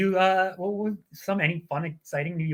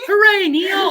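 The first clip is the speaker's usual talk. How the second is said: much higher, louder cheer; 9 dB louder than usual. Real, not acted. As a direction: cheer for a viewer's kind message. Cheering a viewer.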